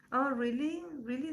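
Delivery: normal talking voice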